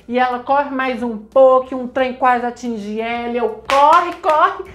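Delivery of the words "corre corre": loud, higher-pitched command; reenacted viewer's urging yell; urgent yelling. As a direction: cheer her on with a frantic shout.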